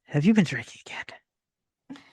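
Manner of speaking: slow, breathy whispered question. Acted. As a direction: lean in and whisper it slowly, confidentially. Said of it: "He kinda whispers".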